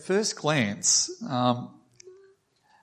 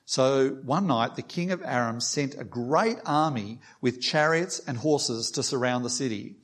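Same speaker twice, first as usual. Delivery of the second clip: usual speaking voice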